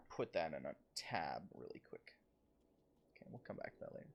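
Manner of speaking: unvoiced whisper, almost to himself; whispered aside. Real, not acted. Streamer whispers a note to himself. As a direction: drop to a private whisper.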